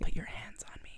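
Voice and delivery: unvoiced sultry whisper. Rose to Jack in the car, whispered. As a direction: breathe the line, no voice at all.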